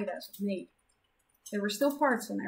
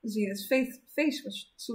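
plain reading voice

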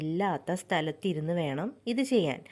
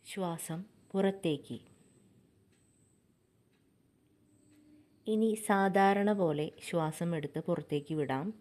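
calm, softer meditation voice with long pauses; guided meditation; 2 semitones lower, 3 dB quieter than usual. Acted. Meditation guide leading listeners through breathing and rising energy, calm and measured. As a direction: guide them gently through each breath and body part, unhurried.

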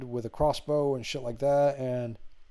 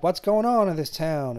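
put-on Southern accent, pitched up; imitated Southern accent; 4 semitones higher, 6 dB louder than usual. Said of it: "putting that Southern accent on hard".

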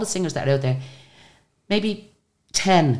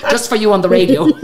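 loud, higher, laughing aside; laughing joke; 6 semitones higher, 9 dB louder than usual. Real, not acted. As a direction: crack up while saying it. Joking with host about name-dropping.

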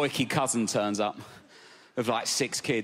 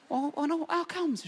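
incredulous. Retold or imitated. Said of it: "I went".

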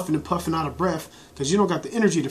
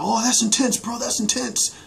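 higher, mock-awed praise of a lazy set; mock impressed; 7 semitones higher than usual. Vlogger mocking gym bros who call resting intense, higher mock-impressed. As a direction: imitate gym bros gushing, pitched up. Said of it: "and they're like".

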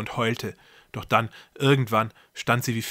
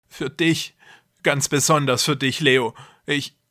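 remorseful brother; 5 semitones higher, 4 dB louder than usual. A narrator's reading. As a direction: say goodbye with reluctant pity. Jakob saying goodbye.

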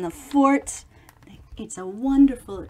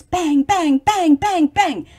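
loud, punchy "bang"s; hammed-up drumming noises; mock banging; 5 semitones higher, 4 dB louder than usual. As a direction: beat out the drum hits. Dragon drumming pots and pans.

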